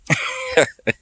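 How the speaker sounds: high-pitched burst of laughter; real high cackling laugh. Real, not acted. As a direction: crack up at your own joke. Laughs at own imitation.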